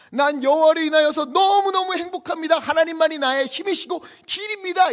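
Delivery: high shouted declaration of joy; impassioned preaching. Acted. Preacher confesses happiness in God alone, impassioned shouted preaching. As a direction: shout it to the back pew.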